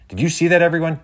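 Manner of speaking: high, loud exclamation; bursting to share it; thrilled. A narrator's reading. Penny asks viewers if they saw that, thrilled.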